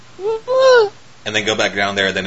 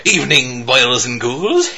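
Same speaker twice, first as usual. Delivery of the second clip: slow spooky host greeting with a laugh; Crypt Keeper; 2 semitones higher, 4 dB louder, much slower than usual. Retold or imitated. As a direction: drawl it like a ghoulish horror host. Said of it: "turns into the fucking Crypt Keeper".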